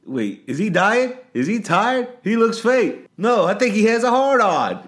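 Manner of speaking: high, loud mock yelling; acted movie quote. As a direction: shout out the movie line.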